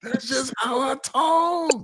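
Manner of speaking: high, slow shout; hammed-up high shout; mock yelling. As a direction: bellow it like a sketch character. Echoing the yelling sketch.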